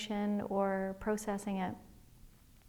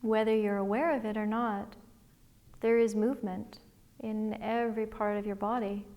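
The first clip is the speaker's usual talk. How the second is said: guided meditation. Acted. Liz leading listeners through a body scan, calm guided meditation voice. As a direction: gently guide listeners inward, one breath at a time.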